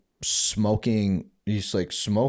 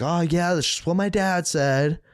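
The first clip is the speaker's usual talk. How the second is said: high mom voice; 9 semitones higher than usual. Retold or imitated. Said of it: "She's like".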